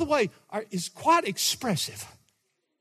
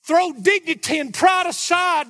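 loud urging; 9 semitones higher, 8 dB louder than usual. Real, not acted. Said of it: "I really wanna try to enact this".

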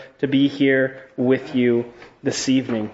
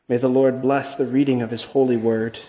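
ordinary talk, nothing marked

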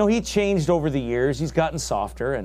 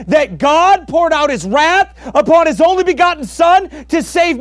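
much higher and louder, shouting; preaching shout; 13 semitones higher, 9 dB louder than usual. Real, not acted. Preacher shouting at listeners, refusing to hold back. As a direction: roar the challenge at the crowd. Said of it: "preaching climax".

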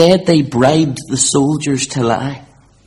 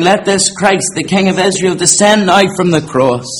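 ordinary talk

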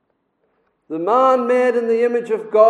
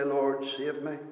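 quiet prayer; 6 semitones lower, 12 dB quieter than usual. Retold or imitated. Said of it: "to say".

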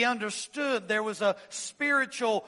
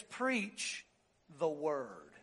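softer, Preach then low the word; hushed emphasis; 7 semitones lower, 11 dB quieter, much slower than usual. Shown as a demonstration. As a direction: drop it to a solemn hush. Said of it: "puts the emphasis on the first three words".

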